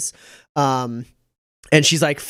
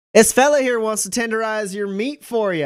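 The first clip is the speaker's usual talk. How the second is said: flirty innkeeper; 8 semitones higher, 5 dB louder than usual. Acted. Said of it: "She goes".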